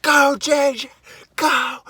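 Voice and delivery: very high, loud cheering scream. Retold or imitated. Fan at the fight screaming for J J, very high loud cheer. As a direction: scream it from your seat for your fighter. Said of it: "I was like".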